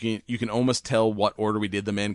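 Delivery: ordinary delivery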